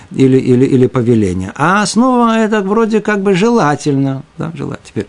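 usual speaking voice

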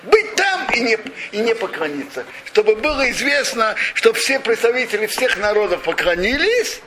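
ordinary talk, nothing marked

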